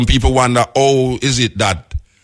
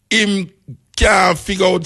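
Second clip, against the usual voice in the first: ordinary talk, nothing marked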